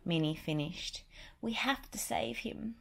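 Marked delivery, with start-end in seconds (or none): 1.4-2.8 whispered high cartoon mouse; urgent Mickey whisper. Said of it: "Mickey agreed".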